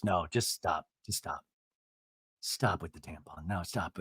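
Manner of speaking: low, soft, imitating a firm stop; quiet weary refusal. Eddie shutting down Tamara's tampon joke, low and quiet. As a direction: murmur it down low, shutting someone up. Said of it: "And Eddie goes".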